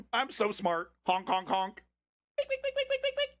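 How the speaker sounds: high, silly clown honking. Pretend. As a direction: honk like a goofy circus clown. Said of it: "put on a little red clown nose".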